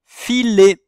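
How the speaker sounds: "filet" called out high and very loud; dictated word. Shown as a demonstration. Teacher dictates a word whose syllables pupils must reorder, "filet" loud and high. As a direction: announce "filet" in clearly separated, ringing syllables. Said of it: "C'est le mot".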